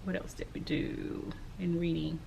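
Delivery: soft whisper, thinking aloud; whispered to herself. Real, not acted. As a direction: whisper the question to yourself as you try to remember.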